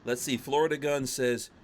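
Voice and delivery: ordinary delivery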